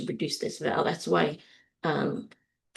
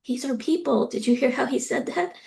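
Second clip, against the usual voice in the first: imitating daughter; 7 semitones higher than usual. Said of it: "my daughter looked at me and she said".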